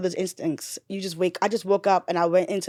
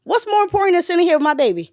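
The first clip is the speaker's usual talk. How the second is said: much higher and louder, quicker; passionate exclamation; 10 semitones higher, 6 dB louder, faster than usual. Real, not acted. Mother defending staying with her baby, loud and high. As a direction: burst out with conviction.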